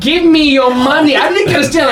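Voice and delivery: high, hammed-up mugger voice; playful fake menace; mock thug. Mock intimidation of NPC.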